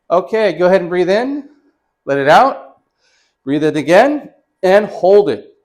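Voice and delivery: loud called-out breathing commands; yelling from behind a wall. Real, not acted. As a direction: call it out loud so the patient hears through the wall. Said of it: "I'm gonna yell at you from behind this wall".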